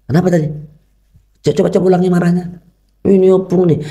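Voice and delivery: ayah membujuk, nada tinggi dan ramah; membujuk lembut. Retold or imitated. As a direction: minta ulangi dengan sabar. Ayah minta anak mengulang. (ayah meminta anak mengulang marahnya, nada tinggi ramah)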